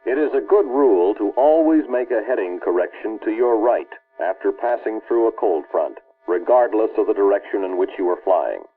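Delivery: usual speaking voice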